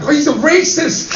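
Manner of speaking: high-pitched, loud mock protest; Ming voice. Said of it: "do it in the Ming voice".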